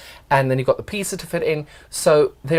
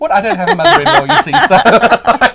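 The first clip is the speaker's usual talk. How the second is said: laughing; 9 semitones higher, 9 dB louder than usual. Real, not acted. Laughing defence.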